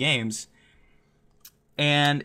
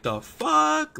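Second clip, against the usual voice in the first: very high, louder, stunned; baffled; 13 semitones higher, 3 dB louder than usual. Retold or imitated. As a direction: squeal it in utter confusion. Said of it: "And I was like".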